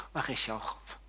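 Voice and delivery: low, soft repeated question; hushed, insinuating challenge; quiet taunt. Retold or imitated. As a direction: needle the listener softly. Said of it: "roept hij tegen jou".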